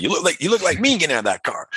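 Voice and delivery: high, quick mock-American voice; bad American accent. Old American ribbing young man climbing from car, bad American accent. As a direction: tease in a hammed-up, poor American accent. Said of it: "a bad American accent".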